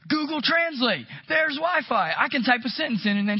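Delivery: the penny drops, delighted; excited realization. Retold or imitated. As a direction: pastor voicing Matt's excited plan. Realising Matt's clever plan.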